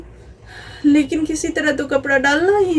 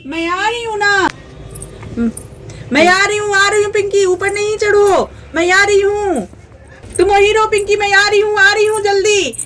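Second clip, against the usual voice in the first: calling out; 6 semitones higher than usual. Acted. Mother calls to child from roof.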